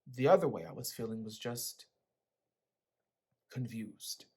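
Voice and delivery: ordinary delivery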